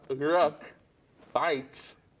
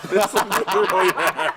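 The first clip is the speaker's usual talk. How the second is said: laughing; 7 semitones higher, 6 dB louder than usual. Real, not acted. Laughing at a silly answer.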